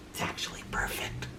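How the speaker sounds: quick whispered aside. Acted. Reader whispering an aside to listeners. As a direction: lean in and whisper it.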